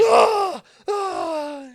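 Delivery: high, loud mock scream of pain; hammed-up agonized screaming. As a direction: yell it like your eyes got plucked. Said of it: "guys screaming".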